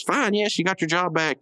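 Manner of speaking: frightened boss giving Benson his job back; hasty mock surrender. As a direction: give in fast, in a hammed-up panic. Mailer gives in, panicked.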